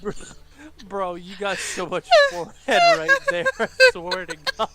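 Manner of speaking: high, loud laughing tease; laughing shout. Real, not acted. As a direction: yell it while cracking up. Teasing through laughter.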